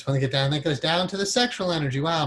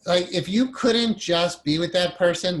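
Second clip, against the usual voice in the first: usual speaking voice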